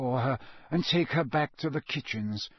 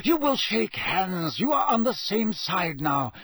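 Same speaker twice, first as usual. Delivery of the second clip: bite of impatience; 6 semitones higher than usual. A narrator's reading. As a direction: let impatience sharpen the words. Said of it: "with a bite of impatience".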